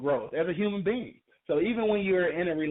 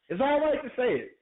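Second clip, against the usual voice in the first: ordinary talk, nothing marked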